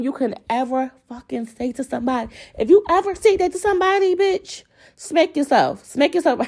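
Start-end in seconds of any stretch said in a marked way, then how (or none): 2.6-6.4 high, heated rant; worked up. Real, not acted. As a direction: scold them from the high end of the voice. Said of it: "I'm getting all, like, worked up".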